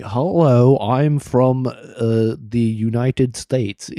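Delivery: imitating foreigners' laboured American accent; overdone American accent. Mimicking non-Americans introducing themselves, overpronounced American accent. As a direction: overpronounce it in mock American, stretching the ending. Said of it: "non-Americans do American accents".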